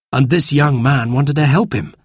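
plain reading voice

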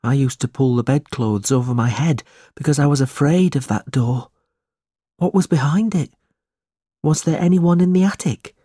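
high, uneasy recollection; fearful, troubled. A narrator's reading. Smike remembering the attic door, fearful. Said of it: "his voice shook as he spoke".

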